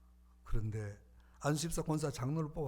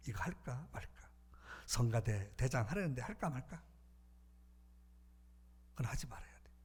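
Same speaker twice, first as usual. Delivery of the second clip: an unvoiced whisper; hushed inner voice; 3 semitones lower, 11 dB quieter than usual. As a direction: lean in and breathe it like a secret. (속삭이는 마음의 소리)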